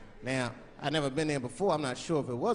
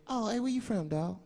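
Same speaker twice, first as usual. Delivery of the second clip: high, chatty driver voice; friendly driver; 7 semitones higher than usual. Acted. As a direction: make small talk as the limo driver.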